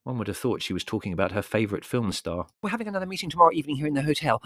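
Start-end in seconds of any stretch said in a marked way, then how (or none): 2.6-4.4 high, rapid gush on one breath; breathless zealous lady; gushing spiritualist woman. Acted. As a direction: race through the invitation till the air runs out.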